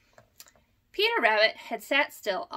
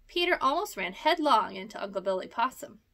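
usual speaking voice

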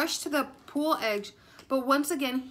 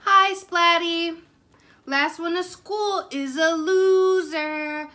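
louder, slow and grinning, a smug schoolyard dare; grinning taunt; 5 semitones higher, 9 dB louder, slower than usual. Acted. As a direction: tease him with a grin, drawing it out. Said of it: "said Spike with a grin".

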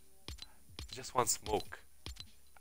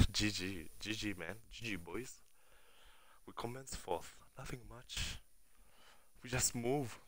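much softer, deflated; soft defeated sign-off; resigned murmur; 18 dB quieter than usual. Real, not acted. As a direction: let the loss go quietly. Resigned after death.

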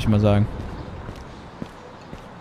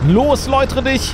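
commanding; 8 semitones higher than usual. Acted. Commanding the sack.

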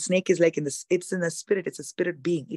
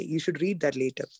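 ordinary talk

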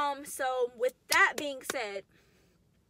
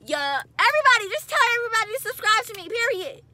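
excited; 11 semitones higher, 14 dB louder than usual. Real, not acted. Excited shout-out plea to subscribe.

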